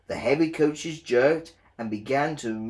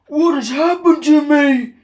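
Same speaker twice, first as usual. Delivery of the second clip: high, loud, dismayed cry; alarmed Gordon; 13 dB louder than usual. A narrator's reading. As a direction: cry out in sudden shock. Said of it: "asked Gordon".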